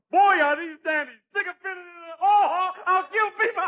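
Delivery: high, laughing delight over the jokes; laughing gleefully. Acted. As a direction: cackle with glee at the jokes. Cop delighted with his jokes.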